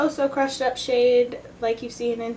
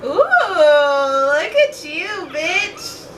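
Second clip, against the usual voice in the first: drawn-out high squeal of delight; excited; 6 semitones higher, 11 dB louder, much slower than usual. Real, not acted. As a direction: gush over the finished look, slow and loud. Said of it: "I'm excited".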